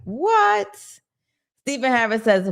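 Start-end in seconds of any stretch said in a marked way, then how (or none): 0.0-0.8 a sharp, much higher, stunned "what?"; shocked what. Retold or imitated. Reacting to polite backstabbers, stunned high "what?". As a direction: jump way up in disbelief. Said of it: "I'm just like".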